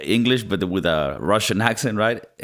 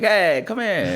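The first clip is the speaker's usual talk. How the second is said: high-pitched, drawn-out movie-star impression; Al Pacino; 9 semitones higher, slower than usual. Likening the character to a film star, drawn-out Pacino impression. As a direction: stretch it out, pitched way up. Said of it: "it was almost like that was Al Pacino".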